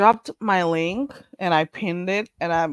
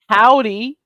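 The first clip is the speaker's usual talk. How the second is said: a louder, hammed-up Southern howdy; attempted Southern drawl; 6 dB louder than usual. Shown as a demonstration. Host tries to copy a guest's Southern howdy. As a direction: try out a cowboy greeting. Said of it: "I'll try".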